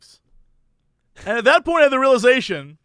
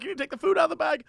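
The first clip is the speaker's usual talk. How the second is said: rapid, higher insistent cashier; lightly voiced hurried clerk; fast cashier lady; 7 semitones higher, 6 dB quieter, much faster than usual. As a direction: rattle off the instruction quickly. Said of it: "She barely has an accent".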